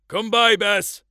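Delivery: loud, high call to the dog; shout. A narrator's reading. Farmer calling his sheepdog back, loud shout. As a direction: bellow it across the hillside. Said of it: "Dad shouted".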